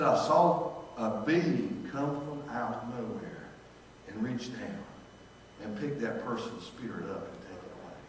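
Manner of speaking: softly, awed, as if still seeing it; hushed awe. Retold or imitated. Guard tells of seeing angels.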